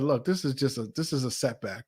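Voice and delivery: inner voice. Retold or imitated. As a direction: tell yourself calmly, almost to yourself. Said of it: "something in me just said".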